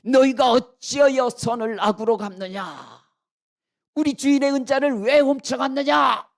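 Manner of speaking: loud, high accusing roar; angry scolding shout. Acted. Steward catching up with the brothers, furious shouted rebuke. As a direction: thunder accusations at the thieves. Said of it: "다짜고짜 막 호통을 칩니다".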